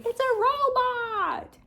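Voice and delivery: very high, drawn-out imitation of excited children; squealed, stretched-out mock child voice; kids calling out. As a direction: mimic kids chorusing the answer. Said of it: "And they would say".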